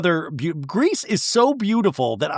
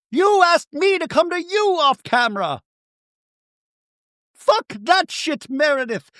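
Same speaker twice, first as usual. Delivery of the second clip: acted outrage; 8 semitones higher than usual. Accuser curses at Meredith, hammed-up outrage.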